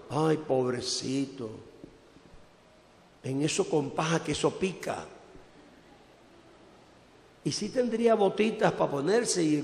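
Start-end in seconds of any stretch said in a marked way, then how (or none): 0.1-5.1 put-on a sigh of pity over the poor baby, slowed right down; pitying church sister. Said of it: "yo he visto a las hermanas hablando". (imitando a las hermanas con lástima, suave y lenta)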